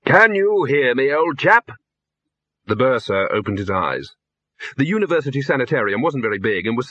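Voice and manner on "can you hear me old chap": higher, slow hearty call; rousing a patient. A narrator's reading. Visitor waking bursar in sanitarium, hearty. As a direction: call slowly to someone coming round.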